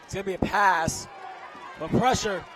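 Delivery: rising shouted call as the ball is tipped; building from eager to full shout; excited play call. Real, not acted. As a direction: yell it out as the pass is nearly picked. Live play excitement, big play.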